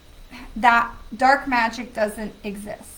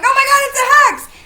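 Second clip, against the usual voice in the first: much higher, louder hammed-up alarm; mock panic; 13 semitones higher, 13 dB louder than usual. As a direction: overreact to a stubbed toe as a curse. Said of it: "we're like".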